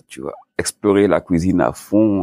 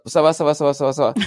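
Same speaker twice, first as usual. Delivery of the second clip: rapid-fire, higher-pitched repeated reassurance; mock rushed phone answer; hurried brush-off; 8 semitones higher, faster than usual. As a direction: rattle it off to get off the phone. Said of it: "T'expédiais la question".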